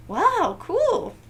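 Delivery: high, loud amazed wow. Real, not acted. Reader marvels at a hundred two floors, high loud wow. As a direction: sound truly impressed.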